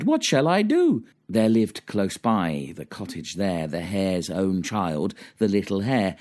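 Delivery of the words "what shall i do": very high, helpless wail; hammed-up storybook wife in dismay; crying out. A narrator's reading. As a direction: cry it out far up in pitch. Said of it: "she cried".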